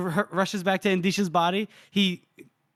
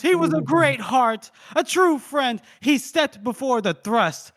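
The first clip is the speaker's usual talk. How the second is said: mock grief; 6 semitones higher, 4 dB louder than usual. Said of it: "And then Costco goes".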